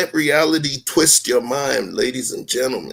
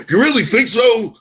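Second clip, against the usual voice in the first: higher, louder emphatic challenge falling at the end; dramatic repeat; 10 semitones higher, 6 dB louder than usual. Acted. Repeating the challenge to his co-host, louder and emphatic. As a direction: hammer the rhetorical question home, dramatic. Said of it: "I'm being dramatic".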